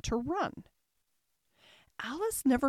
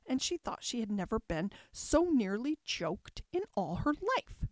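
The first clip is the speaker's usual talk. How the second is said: plain reading voice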